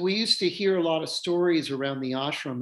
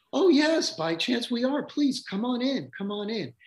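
much higher, sing-song hostess voice; eager welcoming wife; 11 semitones higher, 3 dB louder than usual. Retold or imitated. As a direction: gush like a delighted hostess inviting her in. Wife welcomes housekeeper at door.

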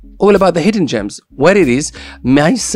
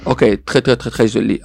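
put-on French accent; 3 semitones lower, faster than usual. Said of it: "a French accent".

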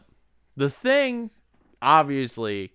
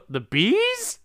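high confused voice; 13 semitones higher than usual. Retold or imitated. Mocking a confused movie character.

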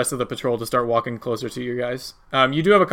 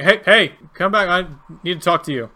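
raised, higher call after someone leaving; guard calling out; 4 semitones higher, 4 dB louder than usual. Acted. Guard to fleeing intruders, calling them back.

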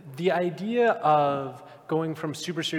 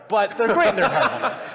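laughing; 5 semitones higher, 6 dB louder than usual. Real, not acted. Calls Yakuza games great and terrible.

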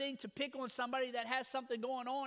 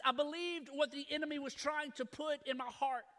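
normal talking voice

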